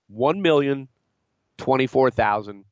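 usual speaking voice

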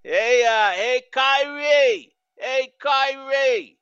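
high, drawn-out Boston heckle; New England accent, high mocking catcall; Boston fan accent. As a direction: mimic a Boston sports fan calling out, stretched and high. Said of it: "that accent".

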